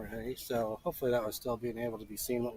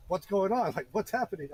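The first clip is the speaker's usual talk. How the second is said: loud, higher-pitched urgent questioning; retold alarm, raised voice; alarmed coworker; 8 semitones higher, 8 dB louder than usual. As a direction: imitate a startled caller demanding answers. Imitates alarmed coworker on the call.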